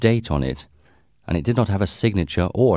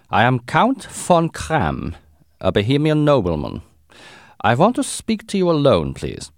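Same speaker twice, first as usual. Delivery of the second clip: put-on strong German accent; 6 semitones higher than usual. A narrator's reading. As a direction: Count with a heavy German accent, pitched up. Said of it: "He had a strong German accent".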